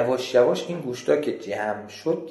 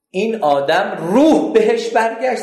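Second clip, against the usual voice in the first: the preacher's voice leaping high and loud; loud high outcry; 10 semitones higher, 7 dB louder than usual. A narrator's reading. Preacher telling the crowd the dead man revived, loud outcry. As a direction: cry it out at the climax. Climax of horror story.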